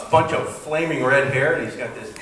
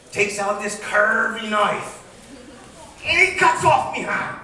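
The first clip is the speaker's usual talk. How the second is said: pirate yarn; 5 semitones higher than usual. Acted. Pirate tells his hook story.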